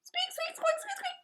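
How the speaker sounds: very high tiny squeaking bird voice; little bird. Acted. The little bird greeting the Yeti in tiny squeaks.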